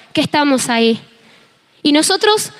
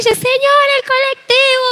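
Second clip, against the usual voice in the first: ruego muy agudo y teatral; súplica desesperada exagerada; 9 semitones higher, slower than usual. Retold or imitated. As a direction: lloriquear rogando, como de rodillas. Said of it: "Faltaba que me arrodille". (ruego desesperado y agudo, en broma)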